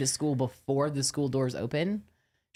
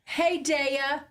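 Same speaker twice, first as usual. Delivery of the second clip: excited; 10 semitones higher, much slower than usual. Real, not acted. Greeting sister in chat.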